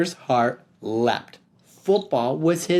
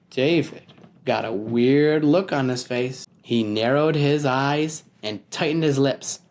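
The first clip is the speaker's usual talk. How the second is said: usual speaking voice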